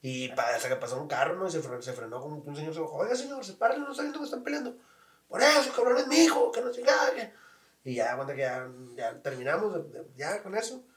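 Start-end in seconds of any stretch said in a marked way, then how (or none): 3.0-4.8 higher, indignant stranger; lightly voiced scolding stranger; mock passerby protest. 5.3-7.3 imitating a tough dad talking back; father's gruff retort.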